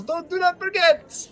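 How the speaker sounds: very high, insistent gnome; comic squeaky send-off; shrill gnome. Acted. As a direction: call out in a shrill little gnome voice. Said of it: "make their voices a little more shrill".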